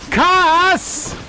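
calling out. Real, not acted. Calling out companion name.

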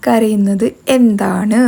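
riddle read out slowly, word by word. Shown as a demonstration. Vlogger posing the day's riddle to viewers, slow and clear. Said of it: "അതാണ് നമ്മുടെ ഇന്നത്തെ കുസൃതി ചോദ്യം".

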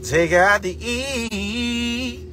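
very slow call, endless last vowel; very drawn-out shout-out. Real, not acted. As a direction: hold the final E extremely long. Same shout-out, stretched further.

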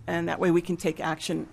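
ordinary delivery

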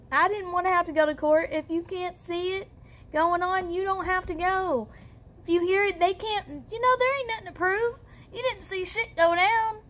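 high-pitched, hurried self-defence; defensive justifying. Real, not acted. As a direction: plead ignorance, pitch climbing as you go. Justifying her claimed ignorance.

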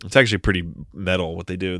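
usual speaking voice

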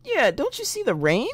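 high-pitched little questioner; high character voice. Acted. Voicing a game character's question.

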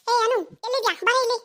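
shouting. Acted. Mother-in-law angrily shouting for her daughter-in-law. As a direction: bellow for her across the house. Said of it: "ಏನು ಅಷ್ಟ್ ಜೋರಾಗಿ ಕಿರಿಸ್ತಾ ಇದ್ದೀರಾ".

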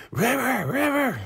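high falsetto call, name repeated; hammed-up plaintive calling; mock calling out. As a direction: call after someone in a put-on squeal. Imitates injured man calling after River.

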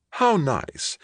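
high-pitched gracious hostess; best hostess voice. A narrator's reading. Wife welcoming an unexpected guest, hostess voice. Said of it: "said in her best hostess voice".

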